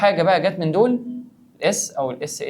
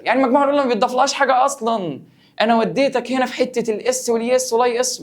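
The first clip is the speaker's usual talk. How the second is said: excited explanation; 9 semitones higher, 4 dB louder than usual. Real, not acted. Teacher explains excitedly after the trick.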